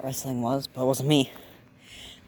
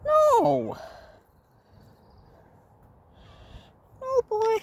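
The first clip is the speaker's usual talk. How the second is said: pet voice; slower than usual. Real, not acted. To the meowing cat, very high squeaky mock scolding.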